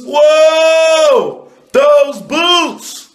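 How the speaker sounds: high, loud shocked shout; hammed-up amazed shout; mock shock. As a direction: yell at the sight of the boots. Shocked at boots.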